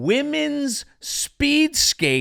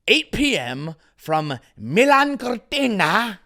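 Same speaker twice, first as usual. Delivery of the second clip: hint of Italian on Milan Cortina; slight Italian accent; 4 semitones higher than usual. Shown as a demonstration. Host reads the Olympic venue with a faint Italian accent. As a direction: lilt the place name like an Italian. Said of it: "that wasn't an Italian accent".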